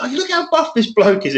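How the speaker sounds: high, gushing imitation; amazed admiration. Man admiring the bodybuilder at work, mock amazement. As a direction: mimic an awestruck fan, pitched well up.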